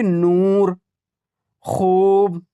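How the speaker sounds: नूर and खूब with very long oo; drawn-out vowel. Shown as a demonstration. Teacher contrasts long waw for learners, नूर and खूब drawn out. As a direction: stretch नूर, खूब right out. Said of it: "ये खींच कर पढ़ा जा रहा है".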